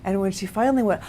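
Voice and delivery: usual speaking voice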